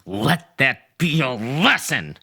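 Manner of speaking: slow, stern scolding; haughty reprimand; chastising. Acted. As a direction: lay down the lesson slowly. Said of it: "I chastised".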